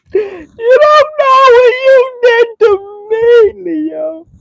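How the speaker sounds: high, loud wail dragged out, then fading; hammed-up sobbing accusation; mock crying. Hurt ex crying out to Leo, melodramatic mock wail. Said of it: "they're crying".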